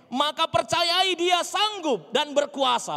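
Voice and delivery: high, loud preacher's proclamation; preaching at full force. Real, not acted. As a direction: urge belief at full volume. (khotbah berapi-api, lantang)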